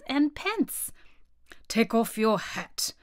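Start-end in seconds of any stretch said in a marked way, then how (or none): 1.7-2.9 slow royal command; measured, weighty order; commanding king. A narrator's reading. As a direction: order it slowly, as a pompous judge.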